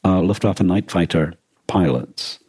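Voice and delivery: ordinary delivery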